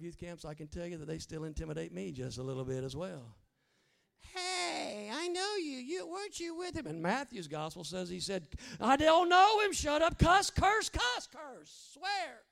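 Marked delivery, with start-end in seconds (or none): 4.3-6.9 put-on high, louder girl's voice; accusing girl. Middle school girl calling out Peter, high accusing voice. 8.8-12.4 high, loud angry outburst; cursing denial. Retold or imitated. Peter cursing at the girl by the fire, loud angry denial.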